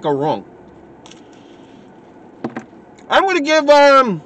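talking while chewing; words muffled by food; mouth full of crust. Real, not acted. Seen 4: Bites crust at 2.3, then chews with closed mouth while talking.

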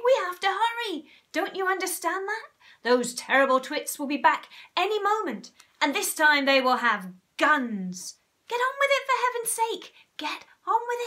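high, urgent pleading; frantic hurry. A narrator's reading. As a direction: push them to hurry before the twits return. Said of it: "in a frenzy of excitement".

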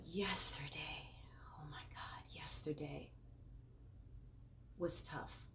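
soft, half-whispered heaviness; hushed distress. Real, not acted. Therapist recalling a tough yesterday, hushed and pained. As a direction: let the voice sink under a painful memory.

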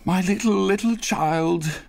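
high, drawn-out grieving wail; father breaking down in grief; anguished cry. A narrator's reading. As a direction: cry out for a lost child, slowing. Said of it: "cried Bob".

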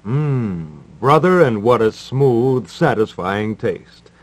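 usual speaking voice

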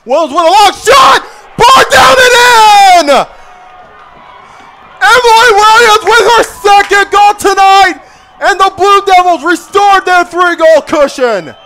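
high, loud, stretched goal scream; long goal yell. Real, not acted. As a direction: let the goal call ring out. Second goal scored.

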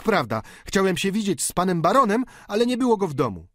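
mock maid. Servant imitates the baroness's maid, high. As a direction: chatter like the lady's maid. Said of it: "powiedziała mi pokojówka".

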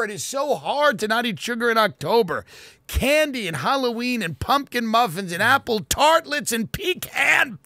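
high-pitched, building comic rant up to a shout; hammed-up frenzy building to a shout; manic rant. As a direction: wind yourself up over sugar until you're yelling.

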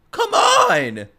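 high, loud exasperated cry; exasperated outburst. Real, not acted. Protesting to viewers about mourning, exasperated outburst. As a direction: burst out in a high, exasperated yell.

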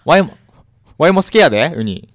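hamming up Kansai dialect for a listener named Kattchan; fake Kansai dialect. Mock Kansai accent thanking a listener. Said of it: "やっべ、関西弁だ。".

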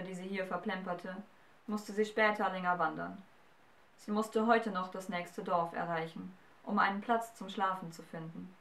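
usual speaking voice

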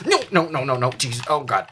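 loud, rising panicked no's; panicked yelling. Real, not acted. Gamer panicking while fighting a monster. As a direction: yell in real fright.

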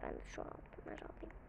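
sneaky whispered aside. Real, not acted. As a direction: whisper it while grabbing. Sneakily grabbing gold.

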